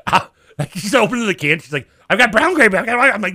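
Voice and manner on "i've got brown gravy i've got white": high, loud mock frantic offer; mom freaking out. Son imitating his mom opening cans, mock frantic. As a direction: imitate a flustered mom, pitched way up. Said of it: "starts freaking out".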